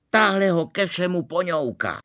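high, loud angry cry; angry accusation. Acted. Princess rushing at Eva, high angry shout. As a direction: snap out the blame.

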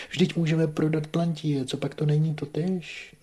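very high, loud objecting question; urgent protest. A narrator's reading. Offering Plantie instead of the farm, urgent protest. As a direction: argue back, high and loud.

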